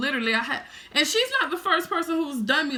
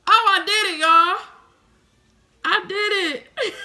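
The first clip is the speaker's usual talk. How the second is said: much higher, louder sudden exclaim; real startled exclaim; 8 semitones higher, 7 dB louder than usual. Real, not acted. Telling viewers she slipped up, startled loud exclaim. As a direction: blurt it out caught off guard. Reacts to own mishap live.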